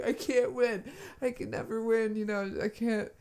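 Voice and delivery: high-pitched imitation of a sore loser; mock-tearful, helpless complaint; upset whining. Imitating her complaint after losing at cards, high and upset. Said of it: "she was really upset".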